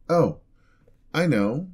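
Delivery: high, loud eureka; light up with the idea; excited little gasp of inspiration; sudden bright idea. Acted.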